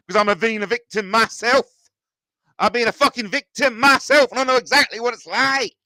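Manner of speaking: loud, high-pitched indignation; indignant outburst. Real, not acted. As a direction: raise the voice as an aggrieved victim. Indignant outburst about own victimhood.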